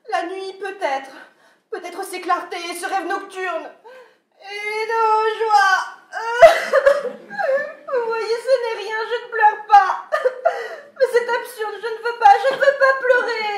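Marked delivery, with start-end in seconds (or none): 4.4-5.8 slow, louder, voice breaking in tears; slowly, crying harder; slow tearful. Acted. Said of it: "je vous entends pleurer". 5.9-7.9 high, loud laugh-sob; crying laugh. Acted. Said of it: "je vous entends pleurer". 7.9-13.6 louder, higher, laughing through sobs; tearful protest, louder and higher; crying and laughing. Acted. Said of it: "je vous entends pleurer".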